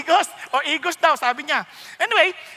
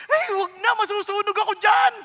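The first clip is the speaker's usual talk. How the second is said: mock refusal; 6 semitones higher, 5 dB louder than usual. Friend refuses church invitation.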